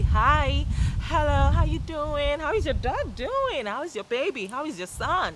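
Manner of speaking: high, loud, lilting small talk; cheerful greeting. Shown as a demonstration. Showing how to start talking to people, cheerful greeting. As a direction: greet someone with big sing-song enthusiasm. Acting out example friendly greetings.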